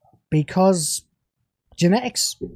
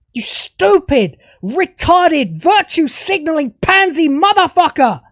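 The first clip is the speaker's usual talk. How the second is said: real fury at the showrunner; raging at the writers of the show; angry rant; 3 semitones higher than usual. Real, not acted. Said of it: "This angry rant".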